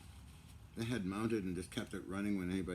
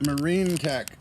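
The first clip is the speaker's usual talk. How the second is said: loud slip; 6 semitones higher, 12 dB louder, slower than usual. Real, not acted. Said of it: "Not Marine tech".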